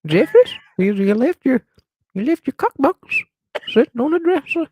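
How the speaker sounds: put-on high old granny voice; scolding grandma calling upstairs. Grandma voice again.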